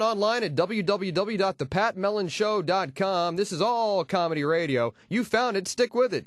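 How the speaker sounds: ordinary talk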